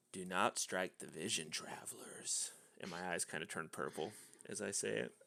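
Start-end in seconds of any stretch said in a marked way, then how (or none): 0.1-2.5 whispered, slow warning; hushed command. Acted. Player's character warning against striking the vision travelers, slow whisper. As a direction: whisper it slowly like an incantation. Casting a warning under magic.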